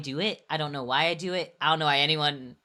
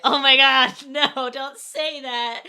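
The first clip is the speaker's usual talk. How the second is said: laughing protest; 10 semitones higher, 10 dB louder, much slower than usual. Real, not acted. Reacting to friend's teasing.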